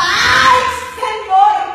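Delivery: a high, loud despairing cry; retold cry. Elijah crying out to the Lord from the cave, high and loud. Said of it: "le gritaba".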